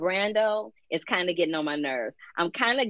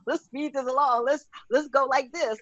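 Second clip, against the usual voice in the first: mock hurried writers; 7 semitones higher than usual. Imitates the writers in a hurry.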